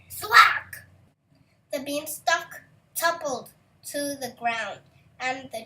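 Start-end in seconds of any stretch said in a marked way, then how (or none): 0.1-0.8 much louder, higher smack sound; loud thwack. A narrator's reading. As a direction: hit the sound word like an axe blow. Sound effect word.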